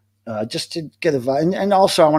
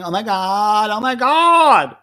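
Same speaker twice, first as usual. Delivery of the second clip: building to a high, loud, drawn-out cry; excited exclamation; 6 semitones higher, 6 dB louder, much slower than usual. Real, not acted. Reacting to Deadwax drinking, loud excited exclamation. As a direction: get louder and higher, stretching the last one.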